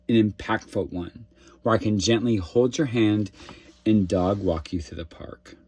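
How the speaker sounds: ordinary talk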